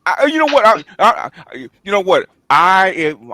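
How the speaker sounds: usual speaking voice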